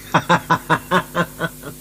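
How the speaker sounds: short amused laugh; a knowing chuckle. Acted. Officer laughs at the prisoner.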